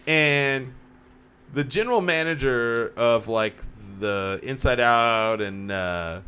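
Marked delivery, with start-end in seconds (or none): none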